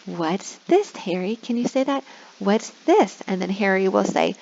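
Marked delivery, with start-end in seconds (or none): 0.0-3.2 kindergarten teacher voice. Shown as a demonstration. Prompting children.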